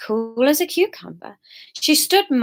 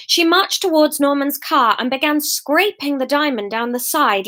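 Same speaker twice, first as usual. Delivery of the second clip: usual speaking voice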